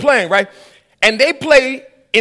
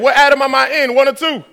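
ordinary talk

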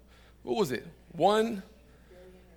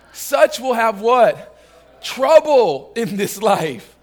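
emphatic preaching; 3 semitones higher, 8 dB louder, much slower than usual. Real, not acted. Preacher prompts married folk to answer, emphatic and loud. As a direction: punch it out for the crowd to answer.

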